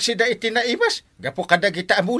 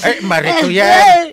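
exasperated shout; 9 semitones higher, 9 dB louder, slower than usual. Acted. Shouting at the fighting women.

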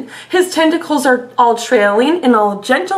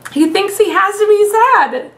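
ordinary delivery, nothing marked

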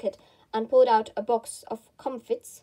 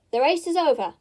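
higher, moderately raised announcement; calling out; 4 semitones higher, 4 dB louder than usual. A narrator's reading. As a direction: call it out to everyone. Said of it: "the Dodo suddenly called out".

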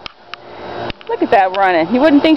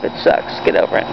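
whisper; 6 semitones lower, 5 dB quieter than usual. Real, not acted. Owner hushed, watching her dog run off. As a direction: whisper it as she runs off.

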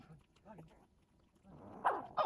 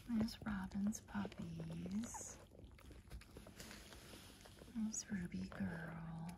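slow, soft, whispery murmur; hushed showing; 4 semitones lower, 10 dB quieter, slower than usual. Real, not acted. Owner showing Robin's puppies, hushed. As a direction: point them out softly and slowly.

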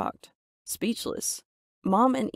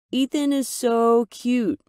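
higher, drawn-out gushing; 7 semitones higher, 4 dB louder, slower than usual. A narrator's reading. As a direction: coo it slowly, pitched up. Mom gushes over daughter's fiance.

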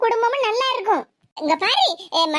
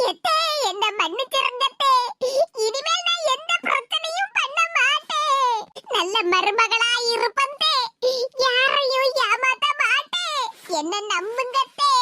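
tearful wailing. Acted. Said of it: "கண்ணீரை விட்டு கதறிட்டு".

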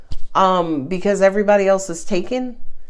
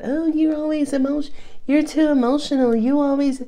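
higher-pitched nagging imitation of a critic; mock complaint, pitched up; 6 semitones higher than usual. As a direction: mimic someone giving them grief. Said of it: "somebody gave you shit about that".

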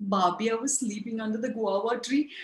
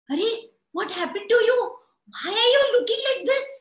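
high, loud, fast fussing wife; a busy wife fussing at her husband; worried wife; 10 semitones higher, 6 dB louder, faster than usual. Acted. Wife to tired husband, high and fussing.

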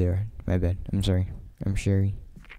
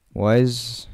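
normal talking voice

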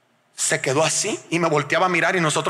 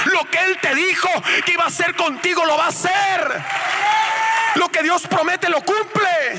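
higher, shouted declaration; preaching shout; 11 semitones higher than usual. Retold or imitated. Husband declaring God's promise to his wife, shouting high. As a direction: proclaim it like a sermon climax.

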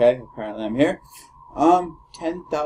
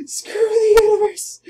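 triumphant shout; 7 dB louder than usual. Real, not acted. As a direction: shout it high and loud. Wins against the odds.

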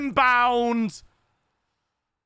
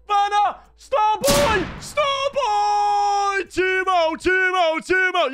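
much higher and louder, chanting the scorer's name; goal celebration; 13 semitones higher, 5 dB louder, much slower than usual. Real, not acted. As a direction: roar each name like a fan in the stands.

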